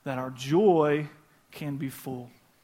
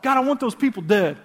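voicing David's prayers; 4 semitones higher, 5 dB louder than usual. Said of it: "every emotion that he felt".

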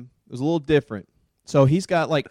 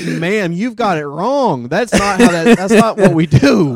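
slow, louder, higher earnest correction; correcting her; 5 semitones higher, 10 dB louder than usual. Retold or imitated. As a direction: set the lady straight, drawn out. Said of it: "I was like".

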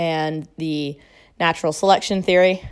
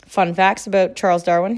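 ordinary talk

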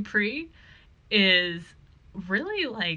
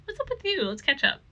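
raised-pitch, fussing questions; high-pitched Scully; 7 semitones higher than usual. Retold or imitated. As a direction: fuss over him, baffled and bright. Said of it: "Scully's like".

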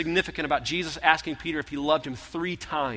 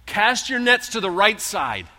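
loud, higher, slow call across the water; 7 semitones higher, 7 dB louder, slower than usual. Acted. Jesus calling from the shore to the disciples, loud and slow. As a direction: shout it to the boat offshore. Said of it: "Jesus yells out".

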